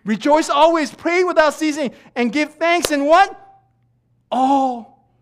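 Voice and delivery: loud, high proclamation of scripture; full-force pulpit call, pitched high; preaching shout. Real, not acted. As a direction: fire the verse out at a crowd.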